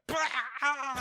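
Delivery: high, loud cry of agony; comic high-pitched wail; mock pained scream. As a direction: yell out a hammed-up burst of pain. Acting out breaking his hand.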